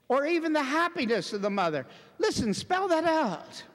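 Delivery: high, loud, slow hammering phrases; indignant preaching. Real, not acted. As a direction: hammer it home phrase by phrase, outraged. Preaches at full force.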